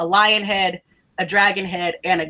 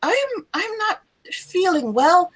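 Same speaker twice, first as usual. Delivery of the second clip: weak sick student; 8 semitones higher than usual. Acted. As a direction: play her faint and unwell. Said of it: "she just says".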